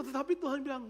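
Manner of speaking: ordinary talk, nothing marked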